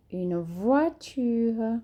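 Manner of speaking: slow, drawn-out Une voiture for learners; slow French teacher demo; careful model reading. Shown as a demonstration. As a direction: spell out each sound slowly. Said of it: "a gente gruda lá no céu da boca".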